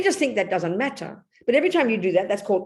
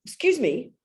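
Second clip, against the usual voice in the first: put-on English accent; 2 semitones higher than usual. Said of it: "this funny English accent".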